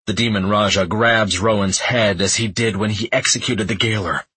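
plain reading voice

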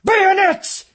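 very high, loud battle scream. A narrator's reading. Colonel screams to his men from the wall, battle scream. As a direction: scream the command to the regiment. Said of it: "and screamed".

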